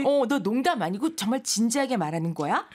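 normal talking voice

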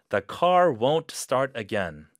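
loud fed-up complaint; frustrated outburst. Acted. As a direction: vent about the dead car. Acted frustration over car.

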